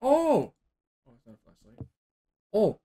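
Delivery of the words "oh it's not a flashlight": breathy whisper to herself. Real, not acted. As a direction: whisper it as a private aside. Whispered aside while searching.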